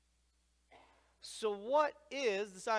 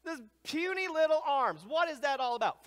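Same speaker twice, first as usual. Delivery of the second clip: much higher, louder puzzled voice; higher mock-incredulous "what are those little arms for?"; mocking the crowd; 11 semitones higher, 7 dB louder than usual. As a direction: play the baffled crowd, exaggerated. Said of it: "everybody's always like".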